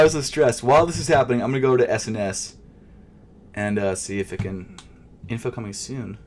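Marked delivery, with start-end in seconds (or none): none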